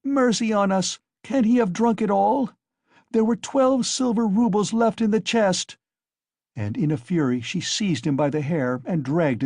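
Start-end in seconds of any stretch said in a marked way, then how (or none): 0.0-5.8 high, loud, frantic; despairing outcry. A narrator's reading. Wife realising the roubles are gone, frantic outcry. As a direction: wail in disbelief at the loss. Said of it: "she cried".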